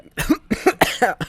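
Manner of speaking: harsh hacking cough; coughing fit. Real, not acted. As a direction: cough hard and rough. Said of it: "excuse me".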